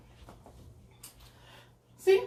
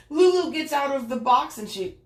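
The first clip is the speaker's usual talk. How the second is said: ordinary delivery, nothing marked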